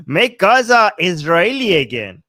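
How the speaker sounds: loud, punchy rally chant; excited slogan. Real, not acted. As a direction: belt it out like a campaign cry. Proudly proclaiming a new slogan.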